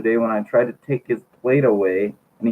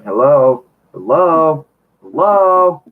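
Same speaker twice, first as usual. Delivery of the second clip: louder hellos, climbing in pitch; narrated shout; 3 semitones higher, 6 dB louder, slower than usual. A narrator's reading. Teen answering a crackly phone line, shouting hello. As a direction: call louder down the line, rising each time. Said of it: "So I shouted".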